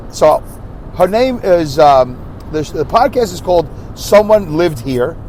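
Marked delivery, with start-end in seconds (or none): none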